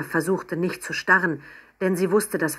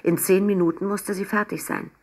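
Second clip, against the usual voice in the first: usual speaking voice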